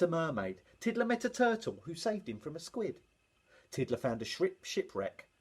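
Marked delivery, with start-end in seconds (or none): none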